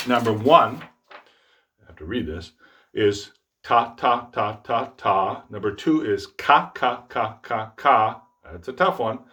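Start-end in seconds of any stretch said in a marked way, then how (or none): 3.6-5.4 "ta" five times, separate and evenly spaced; crisp T on every "ta", all matched; steady counted-out "ta" syllables; even tonguing syllables. Shown as a demonstration. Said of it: "no matter what the articulation is". 6.3-8.2 "ka" five times, separate and evenly spaced; hard K on every "ka", all matched; steady, firmly struck "ka" syllables; strong K syllable. Shown as a demonstration. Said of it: "develop a strong K syllable".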